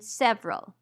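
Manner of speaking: "several" as two syllables, SEV-rul; dropped schwa syllable. Shown as a demonstration. Teacher giving another casual-speech example, schwa dropped. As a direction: say "several" with the middle vowel gone. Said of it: "may disappear completely in casual speech".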